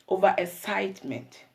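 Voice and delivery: ordinary delivery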